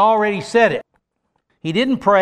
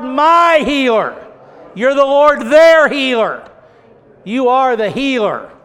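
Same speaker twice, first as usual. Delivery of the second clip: fervent prayer; 7 semitones higher, 6 dB louder than usual. Acted. Preacher leading the congregation in praise, fervent loud prayer. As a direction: cry out praise to God as our healer.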